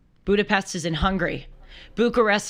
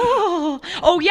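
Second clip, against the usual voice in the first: high, louder "Oh, oh, yes"; Attila gasp; 9 semitones higher, 4 dB louder, slower than usual. Retold or imitated. Host joining the Attila impression, high and louder. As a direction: echo the driver's gasp.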